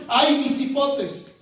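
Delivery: ordinary talk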